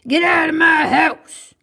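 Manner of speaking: loud, high angry shout; furious storybook yell; mean neighbor yelling. Acted. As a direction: bellow it like a grumpy neighbor chasing someone off. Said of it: "And he yelled".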